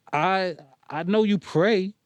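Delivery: stumble, unsure; stumbling over words. Acted. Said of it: "Steph stumbled over her words".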